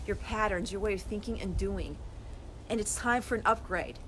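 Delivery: normal talking voice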